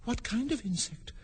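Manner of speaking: put-on soft, high girl's question; high, slightly anxious Alice; a little anxious. A narrator's reading. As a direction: ask it with mild anxiety. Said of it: "inquired a little anxiously".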